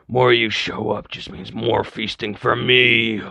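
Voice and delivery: slow, drawn-out menacing zombie growl; monster voice. Acted. Zombie taunting the humans, slow menacing monster voice. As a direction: loom over them, savoring each word.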